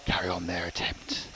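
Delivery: hushed documentary whisper. Acted. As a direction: whisper it like a wildlife narrator. Hushed nature documentary aside.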